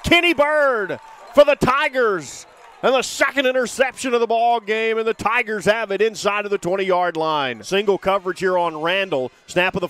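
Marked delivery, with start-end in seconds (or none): 0.0-5.0 rising, shouting interception call; excited play call. Real, not acted. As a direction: build to a shout as he runs. Interception returned, announcer yelling.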